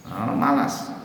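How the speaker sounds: ordinary talk, nothing marked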